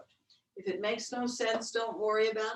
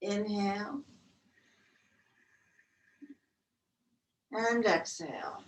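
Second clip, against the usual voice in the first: guided breath count. Acted.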